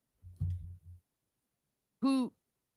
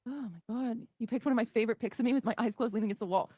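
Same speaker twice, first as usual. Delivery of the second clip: usual speaking voice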